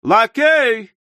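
high, loud summons; bellowing. A narrator's reading. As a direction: holler for a servant. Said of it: "заорал незнакомец".

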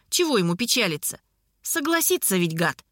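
ordinary delivery, nothing marked

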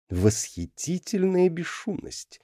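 slow, high, dry sarcasm; sarcastic. A narrator's reading. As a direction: drawl the compliment mockingly. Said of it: "саркастически заметил Джарлакс".

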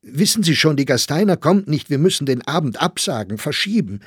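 high, loud breaking of bad news; sheer despair. A narrator's reading. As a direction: rush in with the disaster, despairing. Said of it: "in heller Verzweiflung".

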